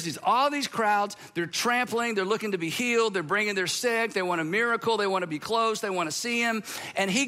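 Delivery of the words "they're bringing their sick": ordinary talk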